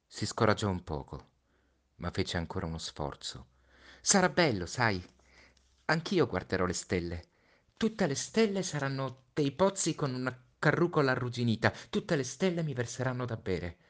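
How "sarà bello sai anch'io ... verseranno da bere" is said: put-on higher child's voice, bravely hopeful; a boy trying to comfort his friend; Little Prince, forcing cheer. (Piccolo Principe, voce acuta, allegria forzata)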